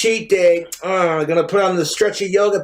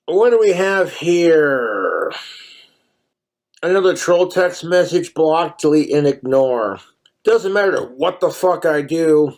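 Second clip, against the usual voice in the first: ordinary talk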